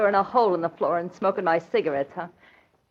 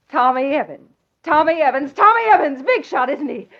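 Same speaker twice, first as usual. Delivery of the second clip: mocking repetition rising higher and louder; taunting; 5 semitones higher, 4 dB louder than usual. Wife taunting her husband with a name, building. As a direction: needle him, building each time.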